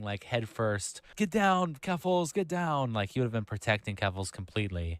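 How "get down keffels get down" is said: pitched-up mock bodyguard yell. Streamer mocks Tipster shielding Keffels, hammed-up bodyguard cry. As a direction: lightly play a bodyguard diving in front of bullets. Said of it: "He would have just been diving".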